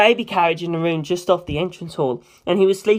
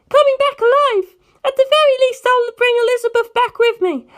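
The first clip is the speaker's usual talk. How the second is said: put-on much higher, louder young girl's voice; a high, fierce girl set on revenge; Penelope's voice; 4 dB louder than usual. Penelope pleads and promises.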